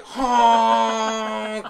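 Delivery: loud high honk sound; horn honk imitation. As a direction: blare it like a car horn. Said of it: "my arm hit the horn, and it was like".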